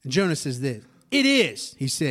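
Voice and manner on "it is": high, indignant snap back; acted defiant outburst, pitched up; angry retort. A narrator's reading. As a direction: answer God in a huff. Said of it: "I'm so angry".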